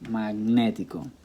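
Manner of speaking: Magnético said slowly with a stressed "né"; slow Magnético, second syllable stressed. Shown as a demonstration. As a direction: linger on the accented "né" in Magnético. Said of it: "on the E".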